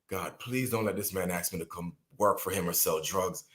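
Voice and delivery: low, softened pleading under the breath; retold anxious prayer, low and soft; hushed inner plea. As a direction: plead privately, keeping the voice down. Silent plea as dealer walks over.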